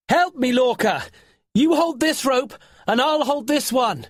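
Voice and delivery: high, loud call over a storm. Acted. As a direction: shout orders to a friend in the wind.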